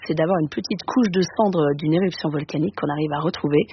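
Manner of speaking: ordinary talk, nothing marked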